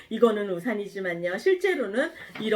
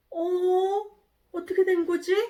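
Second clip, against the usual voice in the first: high-pitched puzzled surprise; acted bewildered question; startled; 7 semitones higher than usual. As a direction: wonder aloud, suddenly baffled. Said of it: "깜짝 놀랐어요".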